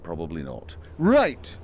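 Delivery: normal talking voice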